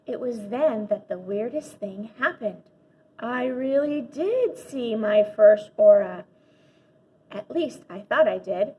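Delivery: ordinary delivery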